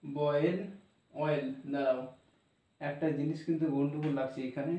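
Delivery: normal talking voice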